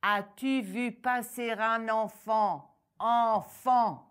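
slow, loud, rising to a shout on Enfant; furious ogress shouting at the deaf farmer; furious repeat. Acted. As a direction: repeat it in exasperation. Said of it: "Furieuse, l'ogresse répéta".

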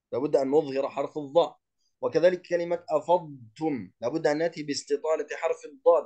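ordinary talk

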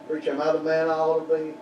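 usual speaking voice

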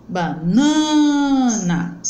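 "banana" with a long drawn-out middle NA; stressed syllable. Shown as a demonstration. Teacher showing the tonic syllable, "banana" held on middle NA. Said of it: "mais força, com mais intensidade".

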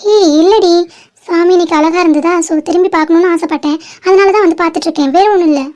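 ordinary talk, nothing marked

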